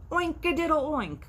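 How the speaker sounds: high, loud mock crow with an oink; pig crowing. Pig mocking the rooster, high and loud crowing. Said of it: "crowed the pig".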